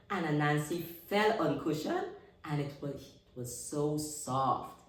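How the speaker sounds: plain reading voice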